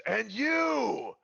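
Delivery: sudden high shout at the bar; ogre yell. Acted. Ogre shouting toward the bar, loud. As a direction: point and bellow. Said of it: "you hear him yell again".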